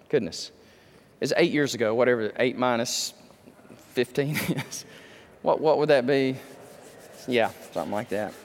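low, soft aside to oneself; thinking aloud. Real, not acted. Preacher fumbling the year of his trip, muttered reckoning. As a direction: work it out under your breath, dropping low. Muddled mental arithmetic mid-sermon.

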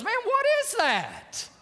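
high, drawn-out astonished exclamation; slow, high-pitched disbelief; amazed outburst. Retold or imitated. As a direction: imitate a man astounded by what he hears. Retells official's amazed reaction.

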